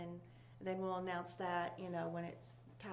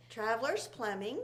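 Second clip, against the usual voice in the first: normal talking voice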